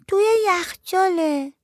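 put-on high, slow child's answer; little girl voice. A narrator's reading. Little girl tells her aunt it's in the fridge, high child voice. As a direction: answer simply, like a small girl.

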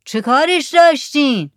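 high, loud call over distance; shouted question. A narrator's reading. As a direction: yell it out to the boat. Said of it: "زکریا داد زد". (سؤال با داد و فریاد)

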